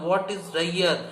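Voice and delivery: usual speaking voice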